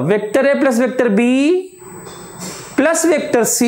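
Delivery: "Vector A plus vector B" run together, long pause, then "plus vector C"; group "A plus B", wait, add "plus vector C"; grouping by a hold. Shown as a demonstration. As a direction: hold after B to mark the bracket. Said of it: "ऐसे".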